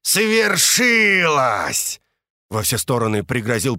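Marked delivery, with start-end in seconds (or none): 0.0-2.0 slow, drawn-out roaring shout, high and loud; bestial roar. Acted. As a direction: roar it out like a beast. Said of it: "прорычал зверино-зычно".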